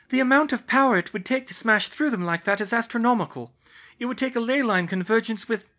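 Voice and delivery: put-on high, loud worried young woman's voice; young witch, anxious and urgent. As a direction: press the others urgently. Hermione voiced in the story.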